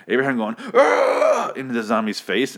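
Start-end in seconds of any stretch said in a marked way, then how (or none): 0.7-1.5 loud, high imitated battle yell; Abraham's yell. Fan imitating Abraham yelling at a zombie, loud mock yell. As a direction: scream it right into a face. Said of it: "Abraham yelling in the zombie's face".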